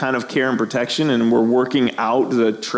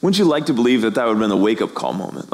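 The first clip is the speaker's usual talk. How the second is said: usual speaking voice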